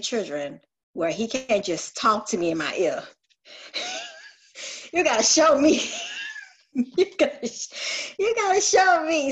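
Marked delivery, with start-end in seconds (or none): 3.5-4.3 a bright high giggle at herself. Real, not acted. Laughs at herself. 4.9-9.3 higher and louder, laughing through the words; laughing plea. Real, not acted. Laughing, demanding God show her.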